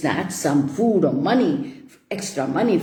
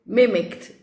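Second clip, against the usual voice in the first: usual speaking voice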